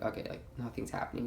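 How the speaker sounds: quick bored whisper. Retold or imitated. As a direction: breathe it out, hushed and fast. Bored reply retold to friend.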